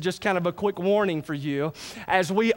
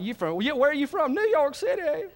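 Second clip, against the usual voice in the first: incredulous question climbing much higher; mocking disbelief; 7 semitones higher than usual. Lula local laughing off a New York City visitor, high mocking disbelief. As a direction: scoff at the outsider, pitch rising sharply to the end. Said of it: "The Lulalite would laugh his face off".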